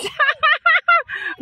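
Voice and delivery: high-pitched burst of laughter; helpless high cackle; laughing. Real, not acted. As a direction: laugh out in a long high run. Laughing after panicky plea.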